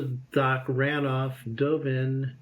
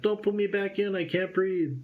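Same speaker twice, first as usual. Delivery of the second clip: mock-panicked plea; 7 semitones higher than usual. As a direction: beg not to go back underwater. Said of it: "And I'm just like".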